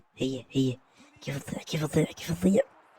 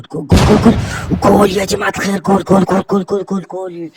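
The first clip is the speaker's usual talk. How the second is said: louder, higher ecstatic goal shout; goal celebration; 8 semitones higher, 11 dB louder than usual. Real, not acted. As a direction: scream the goal like a commentator. (صراخ فرحة بالهدف)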